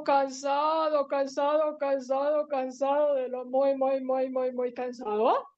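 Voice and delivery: drawn-out trudge, sagging slightly in volume; weary repetition. A narrator's reading. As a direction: run the words together in one long monotone. Stresses how very tired Jacob was.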